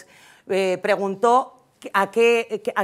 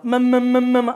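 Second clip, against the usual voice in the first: put-on baby babbling; 4 semitones higher, 5 dB louder than usual. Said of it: "El niño ya habla".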